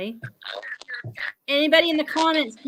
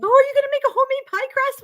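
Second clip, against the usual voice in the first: high, fast, louder mock mom; excited mom; 12 semitones higher, 5 dB louder, faster than usual. Mother visiting, hoping for homemade pie crust, high and quick. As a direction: rattle it off high like a thrilled mother. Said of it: "she's like".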